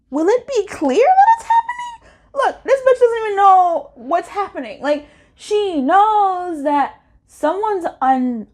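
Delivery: very high, loud, incredulous outburst; exasperated cry. Real, not acted. Reader crying out in exasperated disbelief at the cards.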